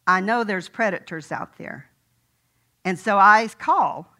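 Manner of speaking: ordinary delivery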